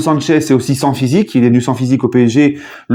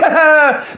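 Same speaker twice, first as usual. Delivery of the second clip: genie laugh; 12 semitones higher than usual. Retold or imitated. Genie boast, then his laugh.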